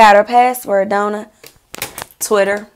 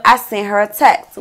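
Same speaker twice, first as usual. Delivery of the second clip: ordinary talk, nothing marked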